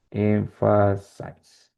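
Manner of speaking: EMPHASIZE drawn out very slowly, part by part; sounding out. Shown as a demonstration. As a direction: say "Emphasize" slowly, as if working out the spelling. Sounding out a word to spell.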